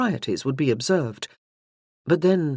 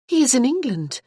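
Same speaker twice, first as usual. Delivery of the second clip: higher, startled exclamation; 9 semitones higher, 3 dB louder than usual. A narrator's reading. Morgan, startled, reacts to the Earl's offer. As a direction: blurt it out, caught off guard. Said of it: "looking up at him startled".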